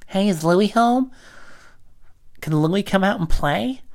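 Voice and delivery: put-on high, slow childlike voice; a shy kid asking at a door; little kid.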